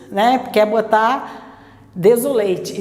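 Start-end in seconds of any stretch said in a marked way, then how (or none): 1.9-2.8 "desolate" com final "lêit", vogal cheia; pronúncia errada. Shown as a demonstration. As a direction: diga o fim de "desolate" como "eight". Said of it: "e não é". ("desolate" sem reduzir a última sílaba)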